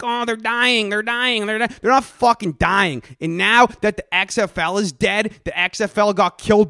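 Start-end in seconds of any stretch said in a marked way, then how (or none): 0.0-1.6 mocking whiny nerd. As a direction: whine it like a doom-saying internet nerd. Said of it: "all you fucking nerds are out here like".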